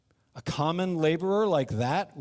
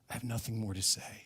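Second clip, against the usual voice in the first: soft whisper, hand over mouth; 4 semitones lower, 9 dB quieter, faster than usual. Real, not acted. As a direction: hush it down to a whisper. Hushed after covering mouth.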